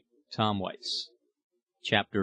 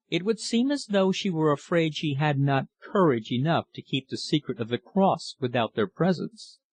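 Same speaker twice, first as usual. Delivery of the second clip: usual speaking voice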